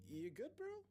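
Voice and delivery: high, rising check-in; concerned roommate. A narrator's reading. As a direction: call after an upset friend.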